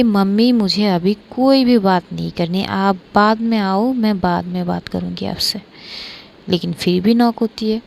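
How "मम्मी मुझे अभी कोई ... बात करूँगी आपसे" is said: usual speaking voice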